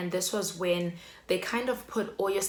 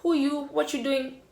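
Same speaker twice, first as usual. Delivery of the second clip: suspicious challenge; 7 semitones higher than usual. Retold or imitated. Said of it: "I'm like".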